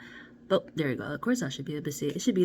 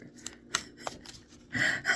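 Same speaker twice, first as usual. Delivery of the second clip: breathy giggle. Real, not acted. Amused at her own joke, a breathy chuckle. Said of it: "I give myself chuckles".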